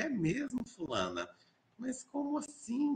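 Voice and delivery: high-pitched incredulous questioning of a student; mock surprise. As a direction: feign astonishment, pitch leaping up. Professor acting out surprised reply to student. (surpresa fingida)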